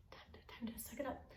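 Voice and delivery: fast, hushed whisper; a quick whisper to herself; whispered self-talk. Retold or imitated. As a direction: whisper it quickly to steady yourself. Pulling herself together after crying.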